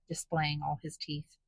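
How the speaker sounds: ordinary delivery, nothing marked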